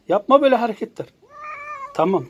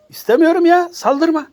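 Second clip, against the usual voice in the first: high, loud whiny refusal; exasperated protest; 10 semitones higher, 4 dB louder than usual. Real, not acted. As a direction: push it away with a wail. Fending off the cat.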